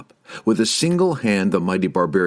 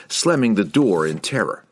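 ordinary delivery, nothing marked

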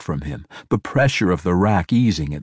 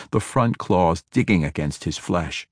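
plain reading voice

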